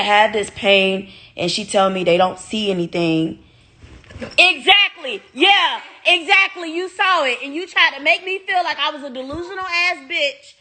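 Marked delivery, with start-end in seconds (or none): 4.4-10.5 off-camera vindication, shouted at friend who saw it; excited shout. Real, not acted. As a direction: crow that you were right all along. Shouting at person who saw bug.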